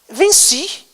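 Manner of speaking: high-pitched cry of victory; lightly voiced devil claiming triumph; gloating devil. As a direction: crow it in one high burst. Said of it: "Deu uma gargalhada no universo inteiro".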